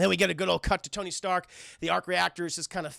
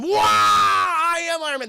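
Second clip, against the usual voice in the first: high, loud, slow excited shout; hyped exclamation; 9 semitones higher, 7 dB louder, slower than usual. Real, not acted. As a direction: shout it in awe and hype. Streamer hyping the line.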